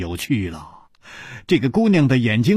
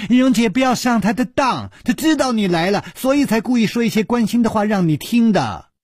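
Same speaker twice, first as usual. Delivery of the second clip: high, fast teasing call; girl loudly warning her sister; 9 semitones higher, faster than usual. A narrator's reading. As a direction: blurt it out quickly and brightly. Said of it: "大声地说".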